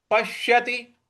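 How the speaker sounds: पश्यति with a held, pushed श; stress on half श. Shown as a demonstration. Teacher demonstrating the stroke, stressed श in पश्यति. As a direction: press into the श of पश्यति on a level tone. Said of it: "उस पर हमें आघात देना है".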